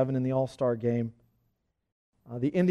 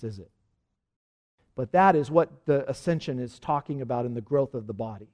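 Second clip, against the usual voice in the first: ordinary talk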